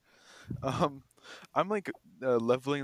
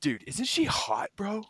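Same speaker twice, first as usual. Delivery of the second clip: slow, higher leering bro voice; creepy stoned guy; 6 semitones higher, 5 dB louder, slower than usual. Retold or imitated. The customer bragging about his girlfriend, slow high leer. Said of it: "He goes".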